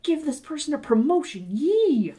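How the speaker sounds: high-pitched cheer with a squealed finish; mock cheerleader. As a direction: cheer like an exaggerated sideline supporter. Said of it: "a little cheerleader on the sideline".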